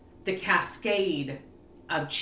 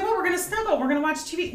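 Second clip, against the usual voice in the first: mock cheerful; 11 semitones higher, 4 dB louder, much faster than usual. Herself after the fight.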